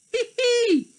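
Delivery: high squeaky giggle; high tittering laugh. Acted. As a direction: giggle like a cheeky monkey. Monkey giggles.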